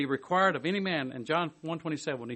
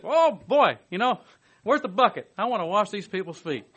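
raised, louder mock-eager volunteer; sarcastic eager voice; mock eager disciple; 5 semitones higher, 8 dB louder than usual. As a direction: ham up a keen volunteer grabbing the bucket.

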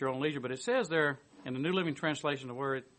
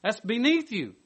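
loud, raised insistent no; emphatic refusal; 7 semitones higher, 9 dB louder than usual. Retold or imitated. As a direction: forbid it outright. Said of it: "his equally emphatic negative reply".

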